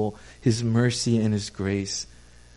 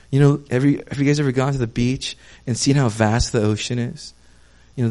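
normal talking voice

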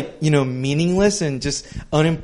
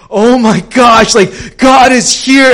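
much higher and louder, slow frightened cry; terrified exclamation; 7 semitones higher, 8 dB louder, slower than usual. Retold or imitated. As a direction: gasp in terror before God. Elijah terrified in wind.

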